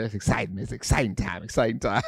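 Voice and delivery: low, soft gangster-style pastor; Wade Bryant. Retold or imitated. Pastor friend on church anniversary, soft low gangster voice. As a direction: imitate a tough guy's quiet, low enthusiasm. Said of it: "he talk like a gangster".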